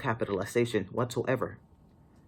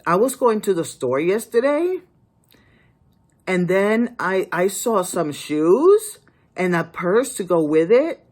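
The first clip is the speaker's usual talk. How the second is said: every phrase lifted like a question, higher; mock the rising question tune; imitating uptalk, raised and louder; uptalk imitation; 5 semitones higher, 6 dB louder than usual. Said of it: "this is how a lot of people speak".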